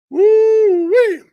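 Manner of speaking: high, loud drawn-out woo-wee; amazed whoop. Real, not acted. Looking at the storm damage, amazed whoop.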